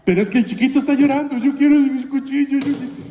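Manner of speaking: put-on high, loud crying whine of a little boy; whining child. Preacher voicing a crying child denied a knife, high and loud. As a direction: wail like a small child wanting his knife. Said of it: "Entonces dice".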